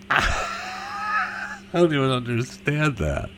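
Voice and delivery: slow, soft words through a laugh; real laughter. Real, not acted. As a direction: laugh your way into the words, softly. Laughs at own joke.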